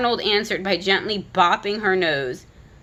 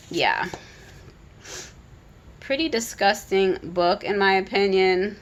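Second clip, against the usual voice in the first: usual speaking voice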